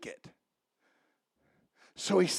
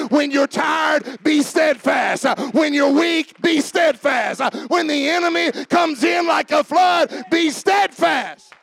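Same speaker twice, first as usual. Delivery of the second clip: full-force preaching; 4 semitones higher, 4 dB louder than usual. Real, not acted. Pastor urging the tired to be steadfast, full-force preaching. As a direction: drive each phrase home, climbing in pitch.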